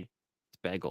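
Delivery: bagel said low and quietly as proof; low, matter-of-fact "bagel" demonstration; pronunciation demo. Shown as a demonstration. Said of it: "we say it the same".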